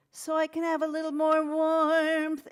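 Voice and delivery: high, loud, shortened and tipped; warm tone. Shown as a demonstration. Teacher repeats the warm tipped demo for the class. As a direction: shorter folds and a tipped larynx. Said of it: "shorten this and tip it".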